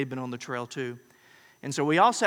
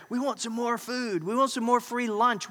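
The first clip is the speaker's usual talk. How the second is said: whiny crowd; 9 semitones higher than usual. Retold or imitated. Crowd voiced demanding perks.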